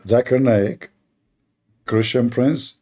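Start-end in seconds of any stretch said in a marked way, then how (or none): none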